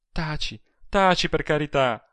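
high, imploring hush; woman's urgent entreaty; pleading. A narrator's reading. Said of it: "pregò ella".

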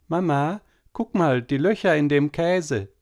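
a hint of a chattering girl at dinner; little girl. A narrator's reading. Girl telling on her sister to mama, lightly childlike.

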